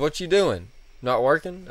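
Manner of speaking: put-on high, loud country-accented needling. Customer razzing the clerk at work, country accent. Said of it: "He's got a real country accent".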